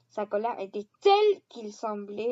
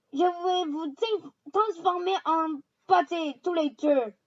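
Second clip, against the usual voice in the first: mock threat; 6 semitones higher, 5 dB louder than usual. A narrator's reading. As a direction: growl it as a threat. Hagrid threatening.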